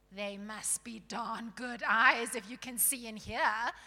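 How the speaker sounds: loud, surly dwarf retort. Acted. Surly dwarf doubting the newcomer can see, scornful. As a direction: scoff loudly as a grumpy dwarf.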